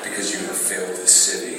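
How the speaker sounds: low, rasping Arrow growl; a hint of a gravel-voiced hero; Stephen Amell voice. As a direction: growl it like the hooded vigilante. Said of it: "that was my Stephen Amell voice".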